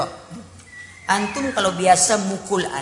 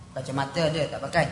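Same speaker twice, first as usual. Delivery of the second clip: ordinary delivery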